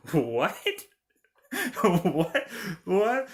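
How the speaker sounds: laughing, questioning disbelief, rising at the end; mock bafflement. Reacting to the film's stilted lines, amused mock bafflement. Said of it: "and I'm like".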